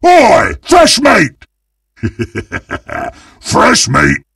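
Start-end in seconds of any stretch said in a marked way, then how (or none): 0.0-1.4 high, loud predatory shout; calling out prey. Acted. 2.0-3.1 soft, gloating snicker; villainous chuckle. Acted. Villain chuckles over prey.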